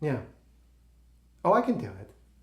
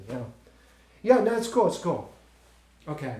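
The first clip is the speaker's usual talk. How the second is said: ordinary talk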